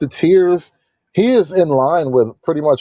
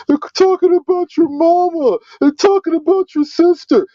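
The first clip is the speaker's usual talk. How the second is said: mock tearful plea. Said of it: "That, that's what those tears are".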